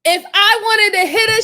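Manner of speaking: loud, heated yelling; furious yelling. Retold or imitated. Yelling at the suspect on screen, loud furious rant. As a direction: shout her down in anger. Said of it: "Bitch, what?".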